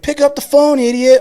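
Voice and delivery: very high, loud mock deputy; yelling female deputy. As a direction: imitate a woman DI hollering an order. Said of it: "Like, yelling at him".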